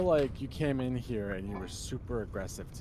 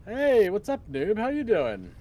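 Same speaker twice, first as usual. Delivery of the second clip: loud greeting; 10 semitones higher, 6 dB louder than usual. Acted.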